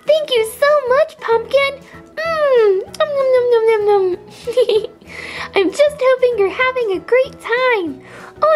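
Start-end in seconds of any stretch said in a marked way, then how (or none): 2.2-4.0 happy cartoon "nom nom" chomping; munch along in play; pretend munching. Treasure eats the surprise bone, pretend munching.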